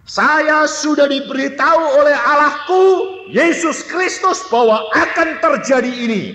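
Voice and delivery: high, forceful proclamation; retold bold declaration. Child telling siblings what God revealed, bold high declaration.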